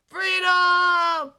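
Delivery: loud, high triumphant shout; joyful shout. Acted. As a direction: shout it as you break free. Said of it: "McQueen shouted loudly".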